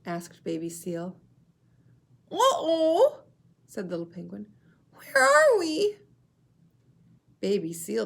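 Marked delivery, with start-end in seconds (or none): 2.3-3.2 high, loud penguin uh-oh; a chick realising they are lost; worried Little Penguin. Shown as a demonstration. Teacher voicing a worried Little Penguin. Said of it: "show you how to use voices". 4.9-6.0 very high, loud, slow penguin voice; a lost chick asking anxiously; worried Little Penguin. Shown as a demonstration. Teacher voicing Little Penguin, lost and worried. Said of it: "show you how to use voices".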